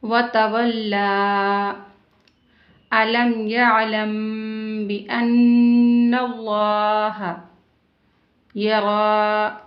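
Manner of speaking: slow, drawn-out tajweed recitation; measured Quranic recitation. Shown as a demonstration. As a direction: chant each word slowly, stretching the vowels. Teacher recites Quran verses.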